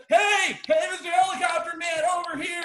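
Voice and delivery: high, loud acted holler; calling out. Lost hiker hails the helicopter man, calling out. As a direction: shout up to someone far overhead.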